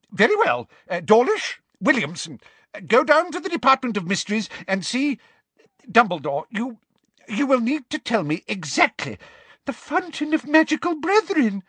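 high, hurried nervous orders; flustered Fudge. Acted. Unsure minister orders his aurors, high and hurried. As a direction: rush the commands uneasily.

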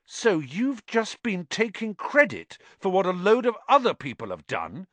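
high-pitched incredulous question; he can't believe the fraud. Acted. Harry confronts Lockhart, incredulous. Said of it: "said Harry incredulously".

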